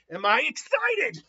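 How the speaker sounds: acted giddy self-questioning; excited realization. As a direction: draw it out with rising thrill. Acting Naruto's dawning excitement.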